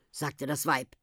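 ordinary delivery, nothing marked

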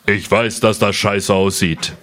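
low, slow scolding kangaroo; schimpfendes Känguru. Acted. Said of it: "schimpft es". (tief und langsam schimpfendes Känguru)

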